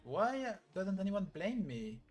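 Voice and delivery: put-on a distraught girl begging to be blamed; anguished plea. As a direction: plead why nobody blames her, drawn out. Grieving character's outburst.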